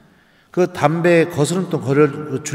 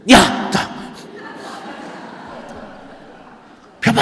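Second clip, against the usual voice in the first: mock yell; 7 semitones higher, slower than usual. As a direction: bark it as the angry mother. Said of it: "내가 이랬어요". (엄마 흉내로 크게 외치기)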